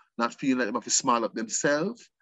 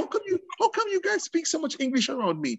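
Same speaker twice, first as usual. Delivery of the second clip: much higher, imitated visitor's question; puzzled foreign visitor; 11 semitones higher than usual. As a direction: ask in a high, wondering voice.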